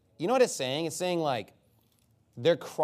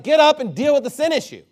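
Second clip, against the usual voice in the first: raised, louder voice of a fed-up God; acted-out forceful scolding; impatient command; 8 semitones higher, 8 dB louder than usual. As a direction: order them to stop moping. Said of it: "he's just like".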